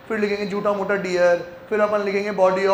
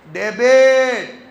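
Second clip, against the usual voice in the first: "Debit" stretched very slowly and said loud; "debit" with a long, loud vowel held out; long drawn vowel; 2 semitones higher, 6 dB louder, much slower than usual. Shown as a demonstration. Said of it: "लंबी वाली मात्रा लगानी है".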